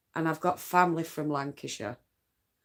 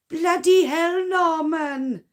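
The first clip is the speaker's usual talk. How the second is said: much higher, loud exasperated Welsh cry; exasperated Welsh exclamation; 11 semitones higher, 9 dB louder, slower than usual. Pretend. Knitter voicing Fireman Sam scolding Norman, loud high Welsh exclamation. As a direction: shout it up high in a Welsh accent, fed up with Norman. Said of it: "We need Fireman Sam for this".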